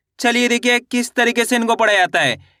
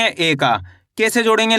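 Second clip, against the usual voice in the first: ordinary talk, nothing marked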